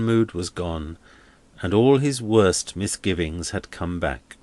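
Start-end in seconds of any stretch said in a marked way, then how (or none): none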